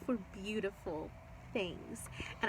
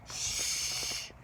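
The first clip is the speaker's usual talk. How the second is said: shushing whisper. A narrator's reading. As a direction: hush her gently. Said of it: "all the furries said together".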